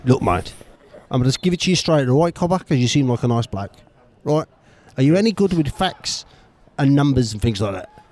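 put-on mild Cockney accent. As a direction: slip into a London geezer. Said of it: "now gone Cockney".

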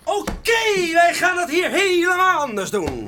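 high, loud bursting-in announcement; loud grand entrance line; barging in loudly. Acted. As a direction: storm in and declare it. Dat zeg ik toch net?.

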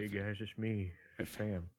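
put-on southern accent; impression sliding into a southern accent; Fam. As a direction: imitate an absent friend with a southern accent. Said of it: "I got this southern accent".

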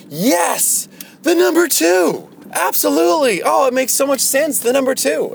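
very high, loud exaggerated excitement; mock gushing enthusiasm. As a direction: crow it like a wildly over-impressed crowd. Imitates crowd's gushing approval.

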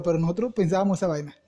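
normal talking voice

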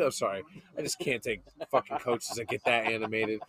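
usual speaking voice

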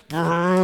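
rolled "brrr" motor sound; buzzing crank-up noise; revving noise. Acted. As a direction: imitate a dial being cranked. Imitates turning up the heat.

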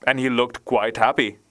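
ordinary delivery, nothing marked

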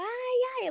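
high-pitched yelp of 'ai'; retold cry of pain. Patient crying out during the biopsy, retold pained yelp. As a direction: imitate yelping in pain, pitched well up. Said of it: "Eu gritava".

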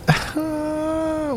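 shrill wheezing giggle; high squealing laugh. Real, not acted. Vlogger losing it over a parody account, high squealing laugh. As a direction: let out a high helpless laugh. Cracking up at tweets.

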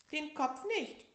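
high, loud, slow demand; bossy sick tiger. Acted. Tiger directs the bandaging, high and slow. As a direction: give orders like a patient. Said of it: "sagte der kleine Tiger".

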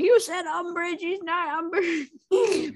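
mock angry fan. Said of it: "It's like".

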